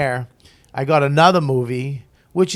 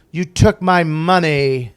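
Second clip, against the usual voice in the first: slow, louder, higher mock effeminate delivery; imitating the actor's camp line at the end of rehearsal; drawn-out effeminate line; 4 semitones higher, 7 dB louder, slower than usual. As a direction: stretch out each word, louder and campy. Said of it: "so he kept doing it".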